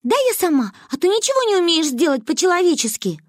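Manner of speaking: put-on cross little girl, pitched very high; high-pitched childish exasperation; Манюня. A narrator's reading. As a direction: grumble bossily in a high child's voice. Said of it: "рассердилась Манюня".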